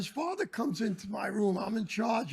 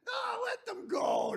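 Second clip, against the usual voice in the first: mock teacher shout; 12 semitones higher, 4 dB quieter than usual. Retelling the lady teachers brushing him off, mocking high yell.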